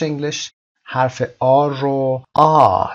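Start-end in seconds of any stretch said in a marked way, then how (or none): 2.3-2.9 آ as a long open "ah"; British R. Shown as a demonstration. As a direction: hold آ with no final R sound. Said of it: "صدای ر در انتها نمیشنوید". (تلفظ بریتیش «آ» بدون صدای ر)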